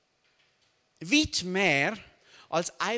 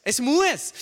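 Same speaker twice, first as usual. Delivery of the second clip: muss punched high and loud; stress on muss; 4 semitones higher, 8 dB louder than usual. Shown as a demonstration. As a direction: hit the word "muss" hard. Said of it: "Mit Betonung auf muss".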